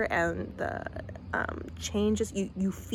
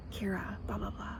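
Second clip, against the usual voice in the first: whispered trail-off; 9 dB quieter than usual. Retold or imitated. Trailing imitation.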